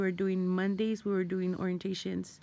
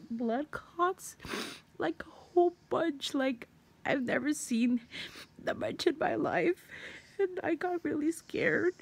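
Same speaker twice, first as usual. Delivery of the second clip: fighting back tears; 6 semitones higher than usual. Real, not acted. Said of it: "I'm trying not to cry".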